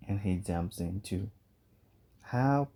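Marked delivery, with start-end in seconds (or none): 2.3-2.7 high panicked cry of help; frightened woman screaming help. Acted. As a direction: shriek it as the dog falls in. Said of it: "Roxanne screams".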